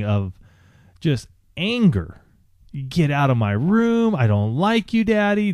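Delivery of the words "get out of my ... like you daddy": a hint of a furious little girl, higher; mock child's angry yell; angry daughter. Father retelling his daughter's tantrum, lightly voiced. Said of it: "she is just crying and just seething".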